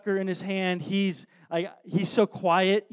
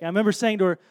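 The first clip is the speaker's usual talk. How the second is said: ordinary talk, nothing marked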